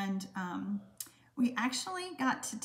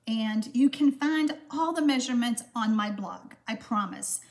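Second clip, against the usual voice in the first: ordinary delivery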